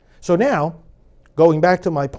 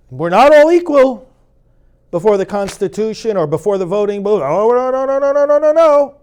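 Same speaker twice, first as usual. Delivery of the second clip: loud ironic denial rising higher; sarcastic; 3 semitones higher, 7 dB louder than usual. Shown as a demonstration. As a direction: lay the sarcasm on thick. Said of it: "let me do that a little bit".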